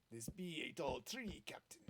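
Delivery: whispered pirate talk. Pretend. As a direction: growl it like an old sea dog. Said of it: "Today also be talk like a pirate day".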